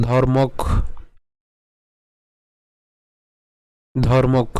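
ধর্মক cut off right after the k; broken-down pronunciation. Shown as a demonstration. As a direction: say only ধর্মক, stopping on the k. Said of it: "ক পর্যন্ত উচ্চারণ করেছি".

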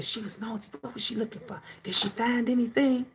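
soft, whispered gossip; hushed kids gossip. Retold or imitated. Older kids whispering about nosy mom. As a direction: murmur like kids sneaking talk. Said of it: "my older ones would always be saying".

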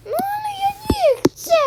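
very high squeaky whine; squealed pretend refusal; whiny refusal. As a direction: play a little one whining in protest. Play figure refuses, whining.

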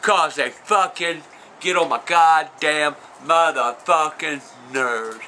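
slow, low, word-by-word grievance; low deliberate griping; slow exasperation. Real, not acted. Explains why he hates cats.

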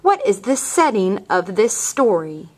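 usual speaking voice

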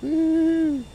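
very high whoop of joy; excited whoop. Real, not acted. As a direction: let out a gleeful whoop. Cheering in the game.

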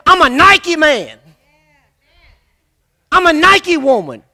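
slow, loud, stressing Nike; proud slow boast; proud declaration. Shown as a demonstration. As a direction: declare yourself a winner. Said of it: "get up on Monday morning and say".

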